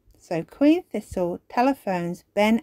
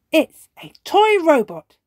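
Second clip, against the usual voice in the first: higher, louder excited cry; 9 semitones higher, 8 dB louder than usual. Acted. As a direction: call it out with delight. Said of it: "cries Ben".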